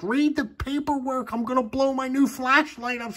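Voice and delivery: high-pitched mock holler at his wife; retold holler. Husband hollers to wife about overheating flashlight, high mock yell. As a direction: yell the warning up high, half-joking. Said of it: "I'm hollering for my wife".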